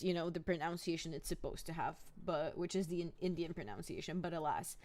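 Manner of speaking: ordinary talk, nothing marked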